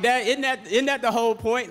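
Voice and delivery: high, loud laughing disbelief; mock outrage at dad's advice; laughing 'isn't that the whole point'; incredulous. Incredulous question to dad, laughing.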